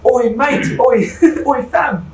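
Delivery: high, loud Aussie caricature; mock Australian accent. Friend showing how Australians sound, loud mock Australian accent. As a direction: call out in an exaggerated Australian accent. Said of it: "Australians sound hilarious".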